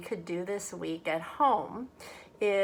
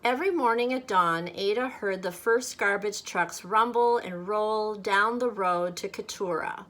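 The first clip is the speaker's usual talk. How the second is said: lively, louder storybook voice; story reading to children; 5 dB louder than usual. A narrator's reading. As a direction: read it aloud like a picture book for kids. Reads picture book aloud to children.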